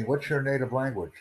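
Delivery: ordinary talk, nothing marked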